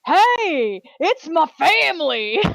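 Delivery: hammed-up announcing of family; half-joking shout to be heard; mock shout. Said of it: "I'm also not like".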